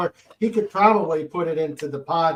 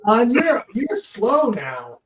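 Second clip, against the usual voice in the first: high, slow teasing sing-song; 8 semitones higher, 5 dB louder, slower than usual. Real, not acted. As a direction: tease him playfully, drawn out. Teasing friend for slowness.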